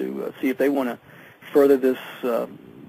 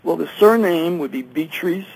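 ordinary talk, nothing marked

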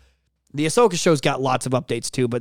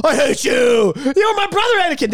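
high, louder anguished quote; mock Obi-Wan shout; 11 semitones higher, 4 dB louder than usual. Host reenacting the Obi-Wan scene, high mock shout. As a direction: yell it like the famous scene. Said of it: "they show the scene where".

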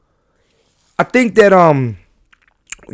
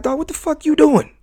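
exasperated at God; 11 semitones higher, 4 dB quieter than usual. Retold or imitated. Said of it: "I say that to God".

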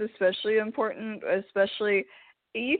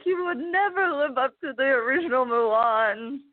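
higher, declamatory mock condemnation; scolding matchmaker; 4 semitones higher than usual. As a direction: deliver the verdict like an outraged matchmaker. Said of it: "when the matchmaker is like".

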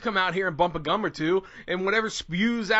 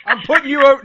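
louder hype man call; 8 semitones higher, 13 dB louder, faster than usual. Acted. Host hypes up his co-host, louder hype call. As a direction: build him up. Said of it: "hyping co-host".

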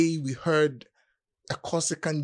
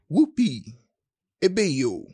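mock cheer; 3 semitones higher, 6 dB louder than usual. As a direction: cry it out like a mocking cheer. Poem's mock-joyful outburst.

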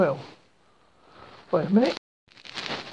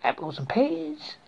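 hammed-up stock British accent; 2 semitones higher than usual. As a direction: parody how British accents get portrayed. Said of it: "most British accents are portrayed".

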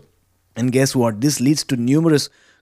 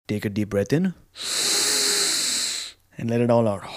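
soft, unhurried guided breathing voice; calm meditative tone; 2 semitones lower, 3 dB quieter than usual. Acted. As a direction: guide them gently through each breath. Leads breathing exercise.

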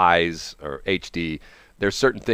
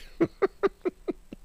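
high squealing laugh; 4 dB quieter than usual. Real, not acted. Laughing at own story.

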